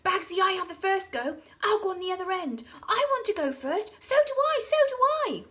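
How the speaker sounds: high, loud eager squabble; children shouting to go first; excited children shouting. A narrator's reading. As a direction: clamour for first go. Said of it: "shouting with excitement".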